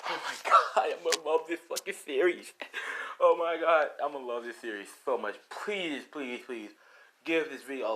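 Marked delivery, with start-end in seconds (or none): 0.1-5.3 loud, higher gushing; real hyped excitement. Real, not acted. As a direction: gush with delight over your own story. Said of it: "I'm getting real hyped".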